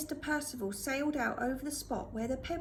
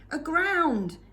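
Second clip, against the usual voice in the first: loud raised yell; 4 semitones higher, 8 dB louder than usual. A narrator's reading. Hideaway yelling back to Fingerbone over the storm. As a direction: fling the word against the wind. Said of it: "yelled Hideaway".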